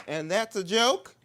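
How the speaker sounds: high, loud and slow disbelief; incredulous drag queen. Retold or imitated. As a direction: ask in disbelief.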